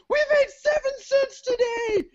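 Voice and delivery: very high, hammed-up shriek of joy; absurdly overexcited retelling; ecstatic squeal. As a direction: shriek it as if it's a fortune. Retells wild joy at tiny earnings.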